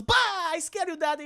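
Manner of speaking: put-on high-pitched child voice; cutesy, high daughter voice; playful little girl. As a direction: pounce with a gleeful scare. Child playfully startles dad.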